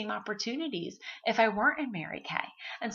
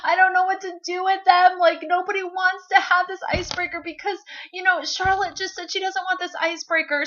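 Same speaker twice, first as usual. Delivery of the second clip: higher, louder hammed-up whine to the husband; mock crying complaint; 10 semitones higher, 7 dB louder than usual. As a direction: play a wounded, whiny wife for laughs. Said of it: "start crying to my husband and say".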